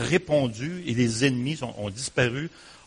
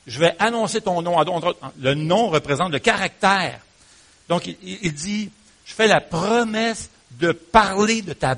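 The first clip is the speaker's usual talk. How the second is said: louder, higher, fervent sermon; preaching at full force; 5 semitones higher, 6 dB louder than usual. Real, not acted. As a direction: preach it out to the assembly. (prédication fervente et portée)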